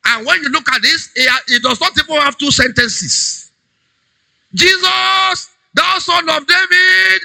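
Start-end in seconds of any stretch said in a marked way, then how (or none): 4.5-7.2 high, sustained pleading call; crying out. Retold or imitated. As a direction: act a beggar hollering for help, stretching the words. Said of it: "He cried it so much".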